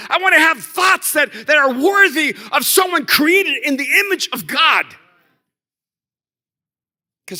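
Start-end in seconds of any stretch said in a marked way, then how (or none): 0.1-4.8 high, loud preacher's cry; preaching at full force. Acted. Pastor crying out to God before the congregation, fervent loud preaching. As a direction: proclaim it like a fervent prayer.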